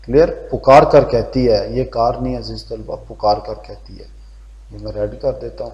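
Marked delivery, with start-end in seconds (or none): none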